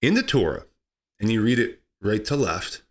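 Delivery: normal talking voice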